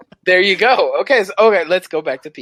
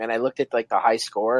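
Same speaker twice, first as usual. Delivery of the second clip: usual speaking voice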